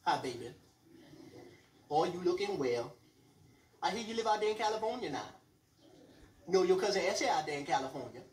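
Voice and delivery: grandma in South Carolina, lightly voiced old lady; mock grandma. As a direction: coo it at your grandson. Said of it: "She was like".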